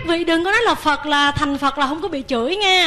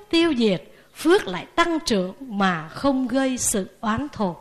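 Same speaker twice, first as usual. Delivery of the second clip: ordinary talk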